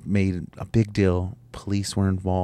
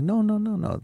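quick denial; 13 semitones higher than usual. Retold or imitated. Retold reply to son.

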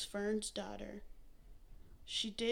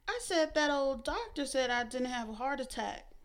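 irritated; 4 semitones higher, 7 dB louder than usual. A narrator's reading. Fern repeats herself irritably.

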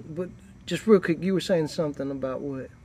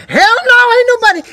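high, loud sassy snap; sassy brush-off; 13 dB louder than usual. Retold or imitated. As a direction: fling it off with attitude, much higher. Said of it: "She be like".

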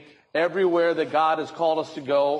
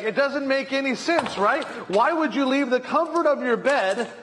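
exasperated wife; 7 semitones higher than usual. Retold or imitated. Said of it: "And she's like".

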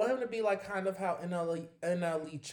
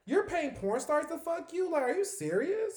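much higher, slow, disbelieving; incredulous; 11 semitones higher than usual. Real, not acted. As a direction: ask it in shocked disbelief. Outraged at the news.

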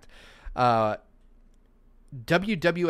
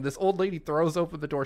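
ordinary talk

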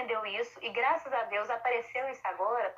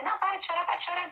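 higher, sharper mock scold; scolding parent; 7 semitones higher, faster than usual. Parent orders a crying child to stop, higher mock scold. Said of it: "Você".

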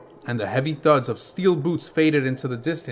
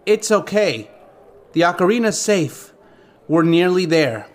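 higher, lightly comforting voice; reassuring fairy; 4 semitones higher than usual. A narrator's reading. Navi comforts Link after the robbery, reassuring. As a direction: buck him up gently in a fairy's higher pitch.

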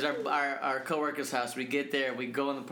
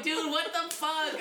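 high, slow mock outrage; exasperated; 13 semitones higher, slower than usual. Friend reacting to the dropped wine, exasperated. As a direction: drag it out, pitched way up.

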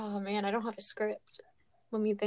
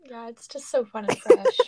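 sarcastic; 9 dB louder than usual. Acted. Sarcastic remark, then "It's not.

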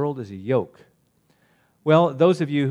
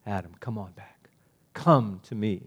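much softer, lower coaxing call; God softly inviting Adam back; gentle invitation; 4 semitones lower, 5 dB quieter than usual. Acted. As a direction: invite him home tenderly. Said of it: "it was an invitation".

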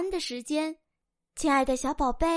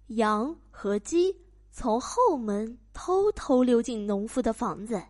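ordinary delivery, nothing marked

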